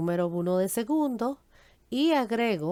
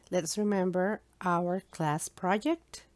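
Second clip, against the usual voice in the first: slow English announcement; slower than usual. Shown as a demonstration. Teacher switches to English.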